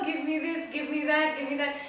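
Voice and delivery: mock-greedy, high and loud; grabby demands. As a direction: rattle off demands like an insatiable mind. Voicing the grasping mind's demands.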